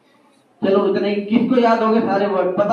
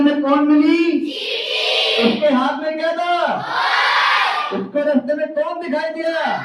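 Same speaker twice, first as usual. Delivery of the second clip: higher, projected quiz questions to the whole class; calling out questions; 6 semitones higher than usual. Real, not acted. Teacher calling story questions out to the children, projected and high. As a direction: call each question out to the room.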